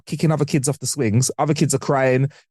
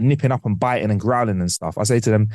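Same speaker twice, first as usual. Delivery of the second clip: ordinary talk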